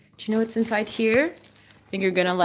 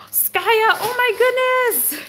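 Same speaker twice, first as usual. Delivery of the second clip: higher, drawn-out excited gasp of delight; thrilled squeal; 13 semitones higher, 3 dB louder, slower than usual. Real, not acted. Owner thrilled at the dog's new toy, in a slow high squeal. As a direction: squeal it slowly at the gift.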